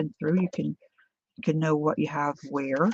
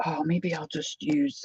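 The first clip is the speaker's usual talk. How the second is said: normal talking voice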